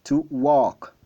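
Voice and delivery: "walk" said "wok", no L; silent L in walk. Shown as a demonstration. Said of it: "Le L est silencieux".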